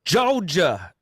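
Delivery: drawn-out, high put-on Georgia drawl on "Georgia"; Georgia accent. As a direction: stretch "Georgia" loftily in a mock Georgia accent. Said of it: "Your, your Georgia accent".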